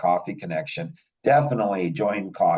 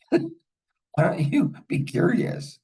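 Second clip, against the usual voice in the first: real laugh breaking into a much higher question; 9 semitones higher than usual. Real, not acted. Laughing while asking the angry friend why. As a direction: laugh it out, incredulous.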